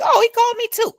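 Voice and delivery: high, surprised retelling; surprised reply. Retold or imitated. Realising he called her too, a high surprised reply. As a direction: notice the missed call with sudden surprise.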